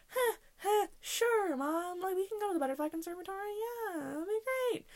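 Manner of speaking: high, small, strained mock cheer; fake agreeable. Daughter agreeing to mom's outing despite dreading it, falsely agreeable. Faking willingness to mom, acted out.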